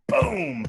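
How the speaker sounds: sharp high boom for a slammed door. Retold or imitated. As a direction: mimic the door banging shut. Door slam imitation.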